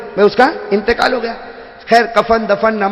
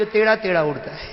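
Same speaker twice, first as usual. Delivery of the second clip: soft teasing jibe with a laugh; mock him quietly; mocking wife; 7 dB quieter than usual. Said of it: "بیوی پیچھے سے بولے گی". (بیوی کا چپکے سے مذاق)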